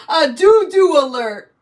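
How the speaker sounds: loud laughing echo mic call; toy echo microphone. Retold or imitated. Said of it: "her, like, Echo mic out".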